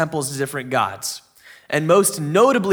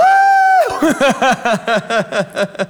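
excited laugh; 7 semitones higher, 4 dB louder than usual. Real, not acted. Cheering at start of sermon.

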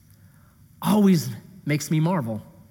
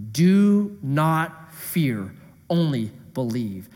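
slow solemn reading; 4 semitones lower, much slower than usual. Shown as a demonstration. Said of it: "This is the meat verse".

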